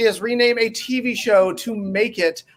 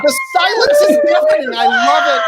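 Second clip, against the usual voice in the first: mock excitement; 5 dB louder than usual. Host mocks panel's silence.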